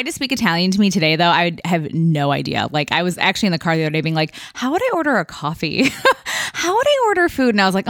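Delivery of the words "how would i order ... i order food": amused self-quiz. Retold or imitated. Imitating herself quizzing in car.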